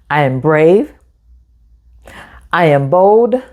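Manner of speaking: plain reading voice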